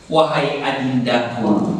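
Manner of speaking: "Wahai adindaku" low, slow and drawn out; poetic intonation. Shown as a demonstration. Trainer demonstrates intonation to class, slow poetic "Wahai adindaku". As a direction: intone "Wahai adindaku" slowly, gliding gently down. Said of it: "nah, itu intonasi".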